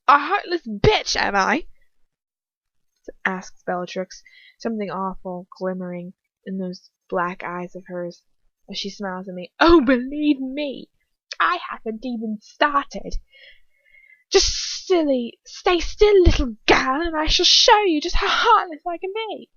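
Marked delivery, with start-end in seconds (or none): 0.1-1.7 loud, high-pitched menace; mocking taunt. A narrator's reading. Bellatrix taunts her crying victim. 9.6-19.5 gloating threat. A narrator's reading. Bellatrix threatens torture.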